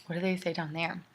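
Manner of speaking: normal talking voice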